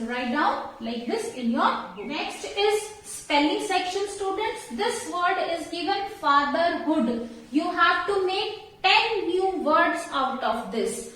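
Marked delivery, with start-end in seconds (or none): none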